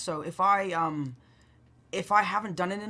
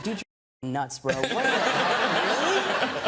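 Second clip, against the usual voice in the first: put-on 1 American teen girl; 5 semitones higher, slower than usual. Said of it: "She's like".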